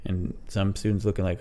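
ordinary talk, nothing marked

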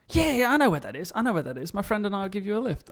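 high, quick, over-eager offer; imitated eager stranger. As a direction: rush in keenly, imitating a pushy helper. Imitating pushy stranger offering a lift.

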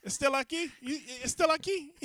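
high, jokey Spanish question; mock Spanish speaker. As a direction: imitate a coworker asking in Spanish. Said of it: "they said it in Spanish".

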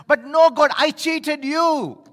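a realization shouted to God; loud, drawn-out admission; loud and slow confession. Acted. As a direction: cry out, slowing on I cheated you. Climax of confession.

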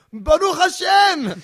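high, loud, slow prayerful cry; old man collapsing, crying out a prayer high and loud; dramatic exclamation. Retold or imitated. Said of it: "my brother said he went".

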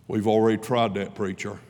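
a hint of a weary Southern man; mild Southern accent, dismissive. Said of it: "He spoke with a Southern accent".

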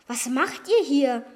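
usual speaking voice

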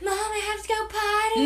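put-on high-pitched little kid plea; whining child. Young daughter to Mom during the film, whiny. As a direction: imitate a kid pestering a parent, voice way up. Child voiced asking mother.

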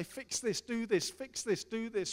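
hammed-up impatient asker; mock demanding prayer.

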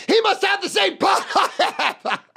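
high-pitched, cracking up mid-sentence; retold stoned laughing fit; hysterical laughing. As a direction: burst out laughing as you get it out. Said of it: "So I'm hysterical laughing".

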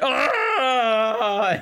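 high hammed-up sobbing cry; mock crying wail. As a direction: mimic a theater audience bawling. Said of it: "everybody else in the audience is like".

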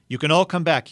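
loud call to the whole hill. Acted. Aslan to his forces, calling loudly that all may return. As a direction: call them back from far off. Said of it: "he said".

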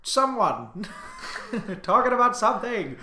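high, loud vintage announcer voice; old-timey radio announcer. Pretend. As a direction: announce it like 1940s wireless. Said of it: "in a very old-timey voice".

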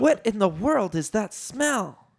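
high, appalled question; high character voice, disgusted. A narrator's reading. Leia appalled by the smell.

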